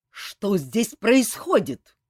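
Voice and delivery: slow, loud bark from the doorway. A narrator's reading. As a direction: colonel bursts in barking. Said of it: "с порога рявкнул полковник Сур". (громкий медленный рявк с порога)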